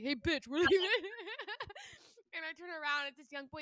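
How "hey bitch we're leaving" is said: loud rough brother voice through laughter; imitating brother, laughing. Joking about how her brother talks, loud and laughing. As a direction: play the crude brother, laughing. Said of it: "he'd be like".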